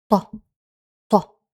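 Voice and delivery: normal talking voice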